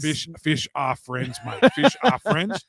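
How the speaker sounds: slow Australian shark voice, lightly done; Bruce the Shark voice. Retold or imitated. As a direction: drag out fish are friends in an Australian twang. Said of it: "talking like Bruce the Shark".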